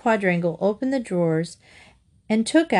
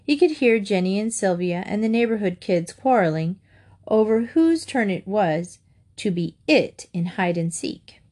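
ordinary delivery

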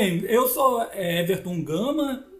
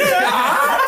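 laughing; 10 semitones higher, 6 dB louder than usual. Real, not acted. Laughing at the joke.

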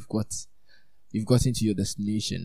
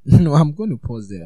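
real laugh breaking into louder, higher speech; amused interruption; 5 semitones higher, 10 dB louder than usual. Real, not acted. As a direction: break off reading with a loud laugh.

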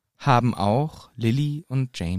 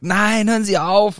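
higher, louder, slow pained cry; anguished plea; 9 semitones higher, 5 dB louder than usual. Acted. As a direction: beg him to stop in agony. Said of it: "Und der Schmerz ist zu fühlen". (gequälter, flehender Aufschrei)

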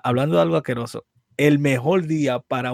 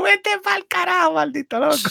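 high-pitched indignant squawk; hammed-up high-pitched outburst; mock outrage; 14 semitones higher than usual. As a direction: tell him off in comic disbelief. Acted outraged reply to friend.

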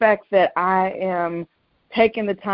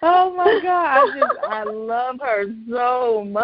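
tearful; 5 semitones higher than usual. Real, not acted. Overwhelmed talking of grandmother.

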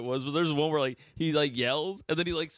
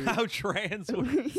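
laughing repeat; 9 semitones higher, much slower than usual. Real, not acted. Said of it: "laughing echo".

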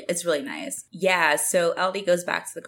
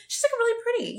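admiring gush. Retold or imitated. Viewer admiring Vula without glasses, gushing. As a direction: marvel in a high, light squeal. Recalls admiring a character's looks.

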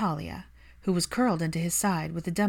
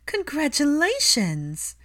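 excited congratulations; 9 semitones higher, 4 dB louder, slower than usual. A narrator's reading. Mother hears of new couple.

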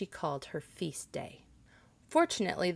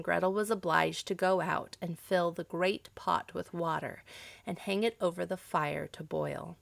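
ordinary delivery, nothing marked

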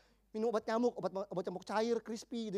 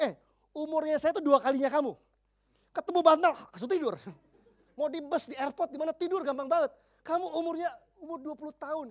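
animated retelling; 5 semitones higher, 7 dB louder than usual. Retold or imitated. Retelling his own scolding.

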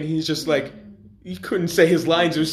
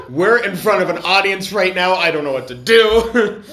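louder, laughing mock panic; retelling his onstage panic, mock panicked and laughing; 3 semitones higher, 6 dB louder than usual. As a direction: replay the panic half laughing. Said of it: "I was panicking".

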